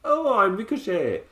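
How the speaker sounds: high, drawn-out mock Irish accent; an American badly attempting an Irish accent; slow, exaggerated put-on Irish; bad fake Irish accent. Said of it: "putting on an accent".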